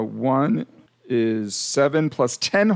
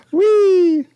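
gleeful whee; 8 dB louder than usual. Retold or imitated. Joyful cheer after laughter.

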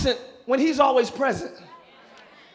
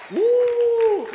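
high ecstatic whoop; sudden high woo of delight; excited woo; 13 semitones higher, 8 dB louder than usual. Real, not acted. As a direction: let out a joyful preacher's woo. Preacher exclaims mid-sermon.

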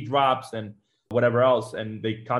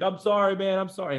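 high, shaky-sounding sorry; apologize, pitched high and upset; tearful apology; 7 semitones higher than usual. Retold or imitated. Buddy apologizing, crying.

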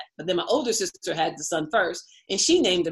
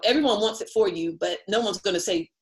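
ordinary talk

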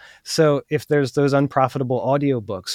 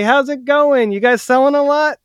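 higher, louder friendly greeting; friendly negotiation opener; 11 semitones higher, 6 dB louder than usual. Shown as a demonstration. Buyer greeting sellers at their table, friendly and upbeat. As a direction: open chattily, pitched up and upbeat. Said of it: "like this".

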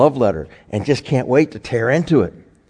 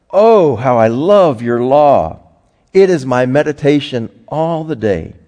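louder, raised reading of a psalm; scripture reading; 4 semitones higher, 5 dB louder than usual. Acted. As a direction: proclaim the verses to the congregation. Reads Psalm aloud.

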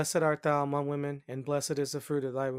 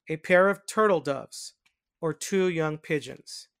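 formal, lifted reading with falling phrase ends; solemn liturgical reader, more melodic and emphatic; scripture reading; 2 semitones higher, 5 dB louder than usual. A narrator's reading. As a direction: announce and read scripture in deliberate, separated phrases. Reads the mystery and scripture.

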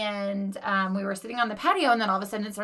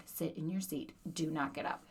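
quiet stern order; 5 semitones lower, 13 dB quieter than usual. Retold or imitated.

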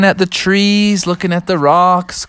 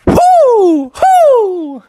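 very high, loud hooting owl; 6 dB louder than usual. Acted. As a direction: hoot right in his face. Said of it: "right up in his face and said".

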